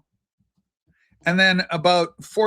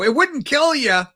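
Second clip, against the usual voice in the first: mock pleading; 8 semitones higher, 4 dB louder than usual. Playful nudge to subscribe.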